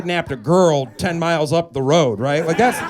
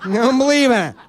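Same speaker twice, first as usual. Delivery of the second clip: mock flustered baker; 7 semitones higher than usual. Baker refusing the fancy cake, high and flustered. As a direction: squeal it like a baker out of his depth. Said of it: "I'd be like".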